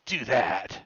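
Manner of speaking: forceful, growled-out command; hammed-up battle taunt; loud in-character challenge. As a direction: shout it as a fighter showing off a kill. Game master voices triumphant fighter's taunt.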